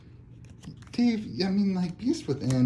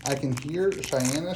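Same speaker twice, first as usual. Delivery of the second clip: ordinary delivery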